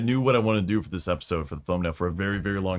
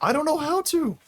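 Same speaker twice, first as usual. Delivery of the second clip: high whiny voice. Real, not acted. Squeaky whine.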